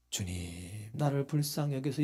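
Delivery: low, soft, composed prayer; 조용한 기도. Shown as a demonstration. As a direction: murmur it far too calmly for an emergency. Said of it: "이렇게 했을까요?". (낮고 차분하게 읊조리는 기도)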